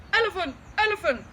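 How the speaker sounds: loud, high cries of panic; panicked shouting. A narrator's reading. Big Bill sees the dragon and yells in panic. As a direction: yell the wrong animal in fright. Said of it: "and he shouted".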